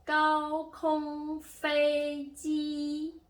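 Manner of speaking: 高空飞机 high, loud, held level and very slow; drawn-out level-tone drill on 高空飞机; first-tone demo. Shown as a demonstration. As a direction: sustain each syllable of 高空飞机 on one high pitch. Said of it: "these are the four tones".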